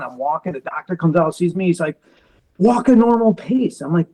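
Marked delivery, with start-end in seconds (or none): 2.6-3.8 raised, insistent retold order; higher-pitched mock scolding; doctor's reprimand. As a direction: mimic a doctor telling off a patient.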